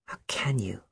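hushed, breathless whisper; murmured. Acted. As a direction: barely breathe the words in shock. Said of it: "murmured Lucille".

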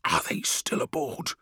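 hushed, anxious-to-be-unheard whisper; quiet whisper. A narrator's reading. Friedish asks Call about the crew, whispering. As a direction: whisper it softly. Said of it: "said Friedish quietly".

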